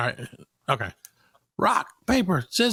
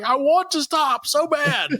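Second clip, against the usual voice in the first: mock whine; 11 semitones higher, slower than usual. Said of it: "I'm like".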